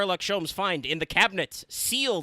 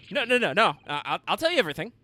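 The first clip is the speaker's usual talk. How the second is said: higher, louder, hammed-up panic, fast at the end; mock panic; 6 semitones higher, 6 dB louder, faster than usual. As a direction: beg in a hurried hammy panic. Character panicking under threat.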